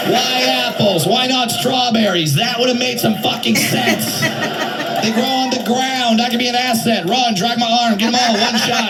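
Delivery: mock grumbling complaint; impression of himself. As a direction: whine about the apples in a put-on huff. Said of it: "Here's my impression of me apple picking".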